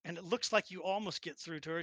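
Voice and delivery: normal talking voice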